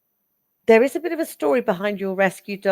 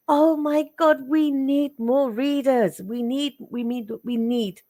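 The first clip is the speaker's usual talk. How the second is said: excited; 7 semitones higher, slower than usual. Real, not acted. Enthusing about spiritual readers.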